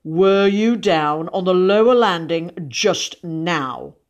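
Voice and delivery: slow, loud, heavily measured question; compressed fury. A narrator's reading. Father interrogating his son about the landing, compressed fury. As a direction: hold the rage in, pressing on each word. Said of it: "said Mister Brown with compressed fury".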